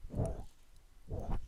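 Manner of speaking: "woo" as Mandarin wǔ, a rounded "oo", whispered; Chinese wu. Shown as a demonstration. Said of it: "you spell it W, U".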